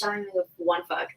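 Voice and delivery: ordinary delivery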